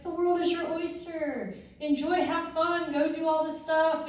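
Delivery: high, chirpy imitation of a friend; breezy encouragement. Friend urging her to enjoy Vegas, breezy.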